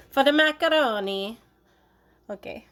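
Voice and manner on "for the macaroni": For the macaroni higher, louder, rising on the; sing-song repeat of For the macaroni; emphatic repeat. Shown as a demonstration.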